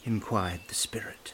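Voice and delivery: ordinary delivery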